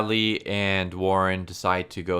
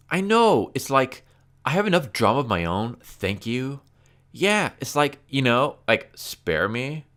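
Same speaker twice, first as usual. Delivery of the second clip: millennial speak; 6 semitones higher, 4 dB louder than usual. Retold or imitated. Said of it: "in their fucking millennial speak".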